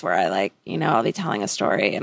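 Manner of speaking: low creaky vocal fry. Shown as a demonstration. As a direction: drag the words into a creak. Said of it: "I talk like this".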